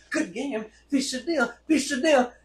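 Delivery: high, singsong sportsmanlike cheer; imitated team greeting. Teachers copying the team after dodgeball, high mock cheer. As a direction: chirp it down the handshake line.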